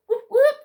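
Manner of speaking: high mock siren whoop whoop. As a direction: yelp it out like a cop siren. Imitating a police siren.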